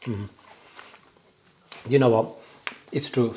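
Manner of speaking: ordinary talk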